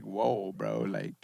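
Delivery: very low, soft retold rebuff; cool him off in a deep, quiet voice; hushed calm-down. Retelling a friend's reply when butting heads, low and hushed.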